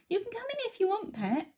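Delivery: high, soft coaxing pet voice; cooing to the cat. Real, not acted. Host calling her cat into the room, high gentle coaxing.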